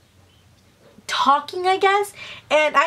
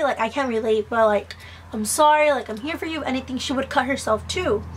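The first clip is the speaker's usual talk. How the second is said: usual speaking voice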